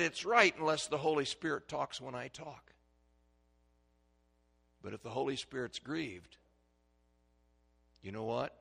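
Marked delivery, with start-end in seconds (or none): none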